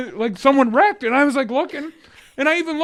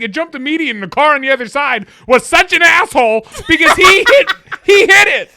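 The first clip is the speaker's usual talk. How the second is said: laughing outrage; 6 semitones higher, 9 dB louder than usual. Retold or imitated. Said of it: "She's like".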